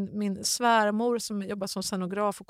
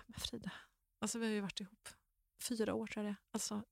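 soft pleading; 10 dB quieter, faster than usual. Retold or imitated. Fiancé begs her to stay.

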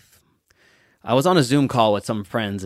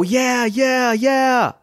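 much higher, louder cheering yeahs; excited crowd yell; 12 semitones higher, 4 dB louder, much slower than usual. Retold or imitated. Crowd answering the walker's challenge, high excited cheer. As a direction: whoop it like a hyped crowd.

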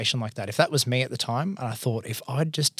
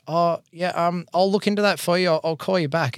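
higher-pitched, hesitant retold phone reply; awkward stalling; 7 semitones higher, 3 dB louder than usual. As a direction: play it casual and stall, voice pitched up.

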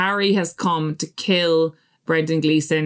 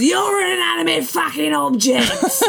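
mock shout, laughing; 10 semitones higher than usual. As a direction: bellow it back at her, laughing through it. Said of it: "And he goes".

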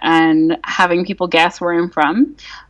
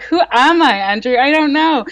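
mock existential crisis; 7 semitones higher than usual. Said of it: "having, like, an existential crisis".

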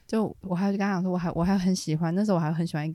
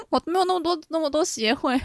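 high, half-laughing whine; mock whine; 9 semitones higher than usual. Re-acts her younger self, laughing.

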